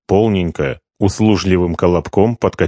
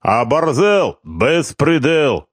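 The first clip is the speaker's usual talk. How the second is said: high, slow indignant Georgian protest; 7 semitones higher, slower than usual. Acted. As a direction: drag out the outrage.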